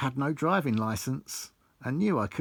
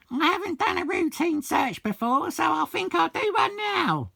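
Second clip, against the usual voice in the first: very high, louder falsetto boss voice; mimicking Charlotte; 4 dB louder than usual. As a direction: squeak it out like a prim assistant boss. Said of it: "Charlotte stated".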